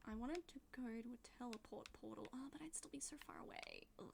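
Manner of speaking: soft unvoiced whisper. Real, not acted. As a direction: breathe it under your breath. Quiet gaming aside.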